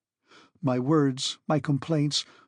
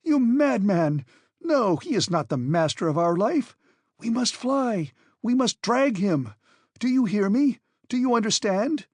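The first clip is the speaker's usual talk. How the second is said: shouting; 7 semitones higher than usual. A narrator's reading. Axel shouts at Hans to flee the professor, frantic. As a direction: yell to drag the guide along. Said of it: "I shouted".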